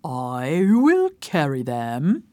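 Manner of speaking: low, slow elephant voice. Acted. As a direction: rumble it slowly like an elephant. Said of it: "said the elephant".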